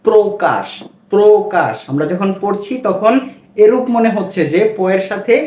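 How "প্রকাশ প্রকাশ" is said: প্রকাশ said as "prokash", o after p; প্রকাশ with a clear o-sound, repeated; model reading. Shown as a demonstration. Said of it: "কি হয়?". (প্রকাশ শব্দে ও-কারান্ত উচ্চারণ)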